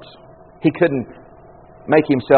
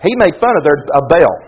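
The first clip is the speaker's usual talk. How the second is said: ordinary talk, nothing marked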